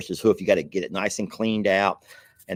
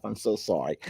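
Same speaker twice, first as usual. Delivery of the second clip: ordinary talk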